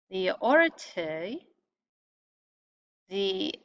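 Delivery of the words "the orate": orate said slowly with a rising end; Latin terms. Shown as a demonstration. Teacher sounding out the Latin class names, slowly. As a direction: draw out orate like a Latin word. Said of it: "groups whose name rhyme nicely in Latin".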